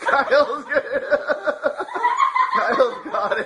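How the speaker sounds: laughing. Real, not acted. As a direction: laugh out the words. Laughing while friend struggles.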